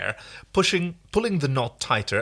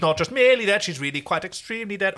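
Munchkin coroner; 6 semitones higher, 4 dB louder than usual. Acted. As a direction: announce it like a pompous official. Quoting Wizard of Oz coroner.

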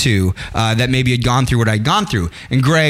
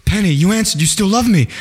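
lightly acted lovestruck man, higher voice; Desmond; 7 semitones higher than usual. Desmond phoning his lost love from the island, lightly acted high emotional voice. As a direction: raise the pitch, mock-amazed she answered. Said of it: "He's like".

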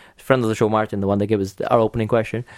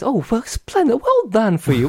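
much higher, put-on voice of a clueless dinner guest; Hugh Bonneville voice; 12 semitones higher than usual. Clueless guest congratulating an actress, high put-on voice. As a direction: chirp it up high like an oblivious English gent. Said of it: "Hugh Bonneville has a great part on it".

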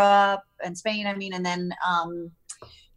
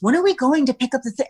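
quick, higher mock-clueless question; clueless husband; 6 semitones higher, 4 dB louder, faster than usual. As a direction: play the husband who never heard a word, fast and up high. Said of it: "And then later he goes".

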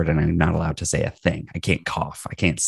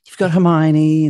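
lightly raised Hermione voice; hint of Hermione; 10 semitones higher, 4 dB louder than usual. Dad reading Harry Potter, a light high Hermione. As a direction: slip into a girlish Hermione.

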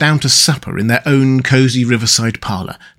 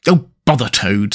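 usual speaking voice

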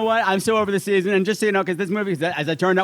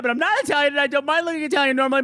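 ordinary talk

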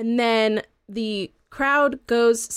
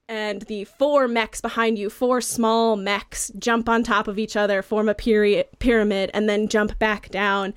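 ordinary talk

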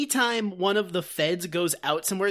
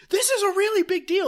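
high, louder nervous squeal; Barney panicking about the gold, high and excited; mock panic; 11 semitones higher, 4 dB louder, much faster than usual. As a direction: play a jittery deputy losing it. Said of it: "Barney starts going".

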